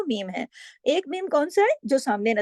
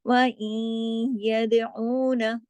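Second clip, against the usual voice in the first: chanted, drawn-out reading; slow Quranic recitation; much slower than usual. Shown as a demonstration. Teacher recites the verse slowly to show the merging. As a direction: let the nasal blend linger.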